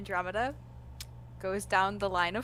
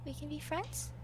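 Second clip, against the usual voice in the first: hopeful gasp; 5 semitones higher, 12 dB quieter than usual. Acted. Delighted at new friendship.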